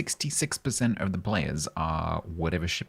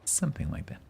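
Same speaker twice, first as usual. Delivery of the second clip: whisper; 9 semitones higher, 5 dB quieter, faster than usual. Real, not acted. Repeats the aside, still whispered.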